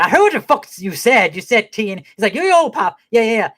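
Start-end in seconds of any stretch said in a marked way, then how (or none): 0.0-2.0 higher-pitched mock indignation; retold irritation. Father correcting his son over what was said, mock indignation. 2.3-3.5 high-pitched imitation of a protesting son; retold placating. Son interrupting his pop, retold high-pitched placating.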